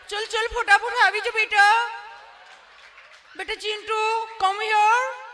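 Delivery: put-on high, sweet educated parent calling her child; lovingly calling child. Educated mother calling her son Chintu, high and coaxing. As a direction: coax the little boy over in a high sing-song. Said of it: "એટલું પ્રેમથી બોલાવે".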